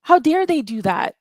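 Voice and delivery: how dare they, fling it out; indignant outburst. Real, not acted. Caller venting to the space host, loud outraged outburst. Angry rant about the car chase.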